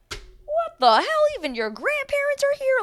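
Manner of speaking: high squealing what the hell, stretched out; a shrill disbelieving squeal, hammed up; exaggerated surprised squeal; mock shock. Mock shocked reaction to family.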